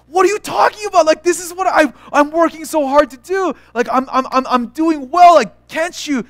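high-pitched, indignant kid complaint, acted out; exasperated protest. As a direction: plead your case to unimpressed parents, worked up. Acted protest to unimpressed parents.

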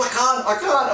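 very high, strained "I can't, I can't"; panic attack. Retold or imitated. Mid-air panic attack, answering the instructor in a high strained voice. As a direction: gasp it out in panic. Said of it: "I started having a panic attack".

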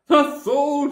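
high, loud triumphant cry; a witch shrieking in triumph. A narrator's reading. Witch to the creatures, a high loud cry. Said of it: "she cried".

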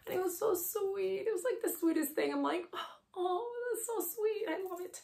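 tearing up over her son's sweet words, voice cracking; choked up. Real, not acted. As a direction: voice breaking high and soft, close to tears. Mother moved by son's words.

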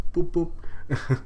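two high, quick boops; imitated boops. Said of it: "she's like".

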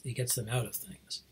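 ordinary delivery, nothing marked

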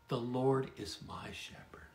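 hushed, unvoiced whisper of the verse; whispered scripture. Real, not acted. Pastor whispering the psalm to his listeners, hushed. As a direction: breathe the line like a prayer.

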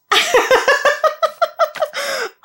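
long high-pitched laugh. Real, not acted. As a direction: burst out laughing. Laughing at the episode.

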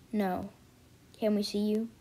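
normal talking voice

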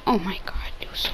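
breathy hush of amazement; awed whisper. Real, not acted. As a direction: whisper it, overwhelmed. Awed by game memory.

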